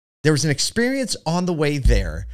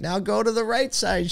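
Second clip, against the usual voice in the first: cheerful yoga instructor; 10 semitones higher than usual. Retold or imitated. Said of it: "She's like".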